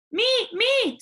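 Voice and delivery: high, loud clamoring; eager daughter. Acted. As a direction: grab the chance first. Daughter shouts.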